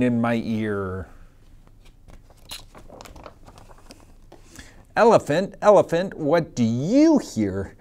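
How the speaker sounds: lively sing-song picture-book reading; reading to children. Acted. Teacher reading a picture book to her class, sing-song. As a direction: read it out to a class of little kids.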